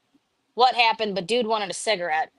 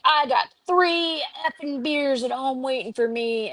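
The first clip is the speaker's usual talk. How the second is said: higher mock yelling patient; angry patient; 6 semitones higher than usual. Patient demanding to leave for his beers, mock yell. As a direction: blurt it like a raging patient. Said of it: "he's like".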